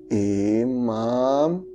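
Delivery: "Imam" stretched out long; long drawn-out name. Retold or imitated. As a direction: imitate him slowly drawing out "Imam". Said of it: "kayak gitu panjang".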